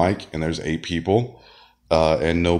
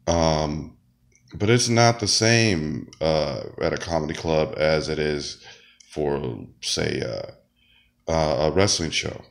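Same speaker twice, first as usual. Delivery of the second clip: ordinary delivery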